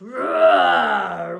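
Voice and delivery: loud, long growly roar; huge growling bellow; bear roar. Acted. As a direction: roar like a big father bear. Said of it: "then roared".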